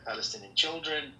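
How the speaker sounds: ordinary talk, nothing marked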